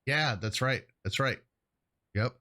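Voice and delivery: ordinary talk, nothing marked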